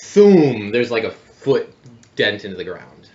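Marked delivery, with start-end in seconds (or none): 0.0-0.7 booming thoom impact sound; dull thud. Acted. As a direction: slam it like a giant club. GM voicing giant club impact.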